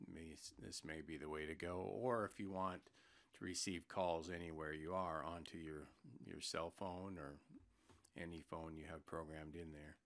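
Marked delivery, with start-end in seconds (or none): none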